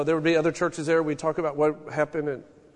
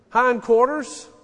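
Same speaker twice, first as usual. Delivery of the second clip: ordinary talk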